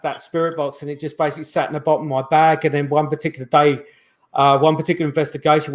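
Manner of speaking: normal talking voice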